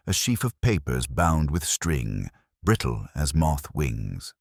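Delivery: ordinary delivery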